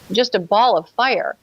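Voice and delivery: ordinary talk, nothing marked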